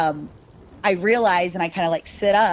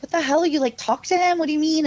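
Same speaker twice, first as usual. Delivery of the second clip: incredulous cousin; 9 semitones higher, faster than usual. Retold or imitated. Cousin shocked she talks to spirit.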